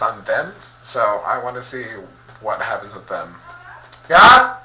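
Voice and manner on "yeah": loud, high questioning shout; raised voice, rising as a question; calling back. Real, not acted. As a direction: holler an answer to someone in another room. Answering someone calling from another room.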